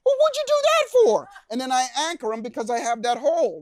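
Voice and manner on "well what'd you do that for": high, loud indignant outburst; imitating a furious, drenched client; angry retort. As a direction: yell at him for soaking you. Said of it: "He's pissed off at me".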